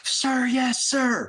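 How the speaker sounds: high, loud recruit shout; shouted sir yes sir. Retold or imitated. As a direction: bark it back at full volume. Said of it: "you're supposed to shout".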